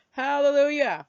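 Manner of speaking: loud, high hallelujah of relief; relieved cheer. Real, not acted. Telling viewers help is coming, a relieved loud cheer. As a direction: celebrate the help like a win.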